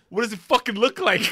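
high, loud you're-the-dumbass comeback; imitated exasperated retort, amused; mock indignant. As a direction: throw it back as if they're stupid. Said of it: "looks at me like I'm the dumbass".

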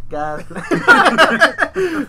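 high, loud, laughing through the line. Pretend. Laughing at his buddy, God's plan through giggles. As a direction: giggle out the catchphrase.

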